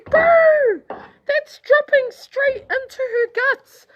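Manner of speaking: very high, loud disbelief; high-pitched grossed-out exclamation; appalled reaction. Retold or imitated. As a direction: squeal it in acted-out horror, starting loud. Acting disgusted thought at video.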